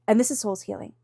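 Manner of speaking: ordinary talk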